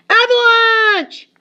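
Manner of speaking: long, high, loud cry of alarm; slow drawn-out scream; screamed warning. Acted. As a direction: yell it across the mountain.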